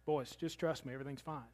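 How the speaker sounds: soft, unhurried and calm; Jesus, just woken, calmly reassuring the disciples; easy reassurance. Retold or imitated. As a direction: settle the boys down gently. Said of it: "Gets up".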